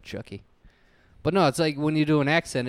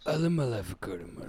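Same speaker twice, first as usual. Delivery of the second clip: quick, lightly voiced tough guy; a hint of Vin Diesel's action-hero growl; Vin Diesel impression; 4 dB quieter, much faster than usual. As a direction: Vin Diesel bit, half-hearted. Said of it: "hear [name] do Vin Diesel".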